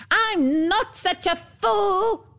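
high, slow, indignant dwarf; mock scream. A narrator's reading. Dwarf retorts to Rose Red, screaming in mock indignation. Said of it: "screamed the dwarf".

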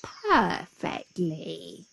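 very slow, satisfied drawl; sly jackal, drawn out; grinning. A narrator's reading. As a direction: savour the word with a grin. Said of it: "grinned the jackal".

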